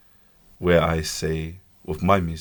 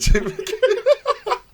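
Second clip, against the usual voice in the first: laughing; 3 dB louder, much slower than usual. Real, not acted. Laughing repeat of the name.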